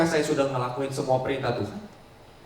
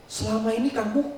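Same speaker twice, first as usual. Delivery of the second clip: angry wife; 8 semitones higher than usual. Acted. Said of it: "marah sama suaminya".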